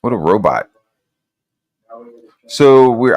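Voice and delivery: ordinary talk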